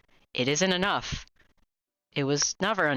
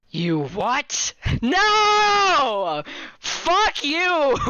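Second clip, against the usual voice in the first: loud, high indignant yell; outraged shouting; 11 semitones higher, 6 dB louder, much slower than usual. Real, not acted. Player yelling at the game text, loud and high. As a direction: scream it at the screen.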